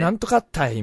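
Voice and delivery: demonstrated Hakata dialect phrase. Shown as a demonstration. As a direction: show off an exaggerated Hakata "ったい". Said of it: "普段でも出ないような博多弁なのよ".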